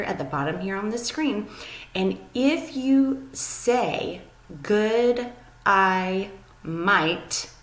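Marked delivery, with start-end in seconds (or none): 4.6-7.5 "Good, I might" said as separate, spaced-out words; accent trick broken down. Shown as a demonstration. Teacher showing students an accent trick, "Good, I might" spaced out slowly. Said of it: "really kind of quickly and smoothly".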